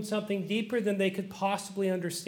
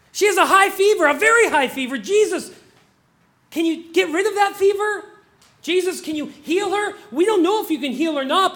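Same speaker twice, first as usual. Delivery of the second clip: high, loud, insistent begging prayer; urgent pleading; 7 semitones higher, 6 dB louder than usual. Acted. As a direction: cry out for help, piling plea on plea. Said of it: "the tone that you're gonna get".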